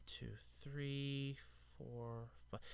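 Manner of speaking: whispered count, voice dropped right out; counting under breath. Real, not acted. Vlogger counting his flat tires to himself in a whisper. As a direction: tick them off in a near-silent whisper.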